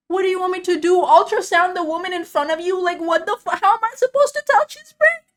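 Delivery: loud, high-pitched screaming rant; angry outburst. Retold or imitated. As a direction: explode at the boss in exasperation. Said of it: "I'm so angry".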